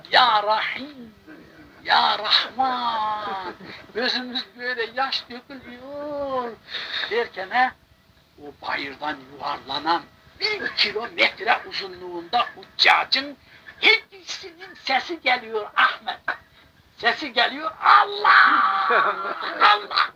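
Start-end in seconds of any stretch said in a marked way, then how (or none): 0.1-3.5 high, very slow prayer cry; slow, raised pleading cry to God; slow fervent cry. Retold or imitated. Pilgrims crying out in prayer. 17.8-20.1 high, loud cry of Allah; high ringing calls; calling out. Retold or imitated.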